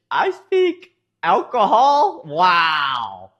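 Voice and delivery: high, loud, stretched-out disbelief; can't believe what she just read; real outraged surprise at a chat message; incredulous. Real, not acted.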